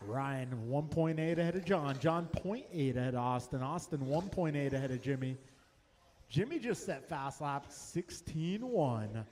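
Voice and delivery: brisk live race calling, lap times rattled off; race play-by-play. Acted. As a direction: call the race live for the crowd.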